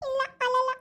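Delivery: put-on high little-child voice holding back tears; tearful denial. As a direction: insist you've stopped crying, still teary. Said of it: "ಅಳಲ್ಲ ಅಂತಾನೆ ಇನ್ನೂ ಕಣ್ಣಾಗ್ ನೀರ್ ಅದಾವ".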